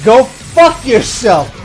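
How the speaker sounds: irritated retort. Acted. Griping back at annoyingly positive people, exasperated. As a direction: snap back, fed up.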